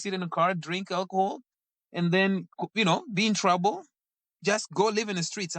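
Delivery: lightly voiced brother, telling it straight; blunt older brother.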